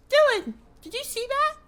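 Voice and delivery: very high, loud squeaky call; mock panicked squeal. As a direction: squeal it in alarm to your cousin. Retold alarm to his cousin.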